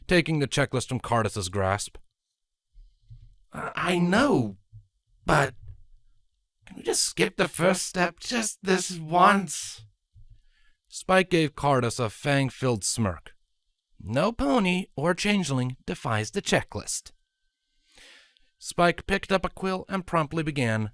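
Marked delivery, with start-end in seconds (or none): 3.5-9.8 slow, high pleading voice. A narrator's reading. Cardus pleads to skip. 14.0-17.1 smug teasing. A narrator's reading. Spike teases Cardus.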